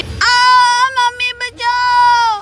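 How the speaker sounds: slow mock cry for help; a small girl calling mummy; distant child cry. Child cries from bathroom. (बच्ची की डरी पुकार)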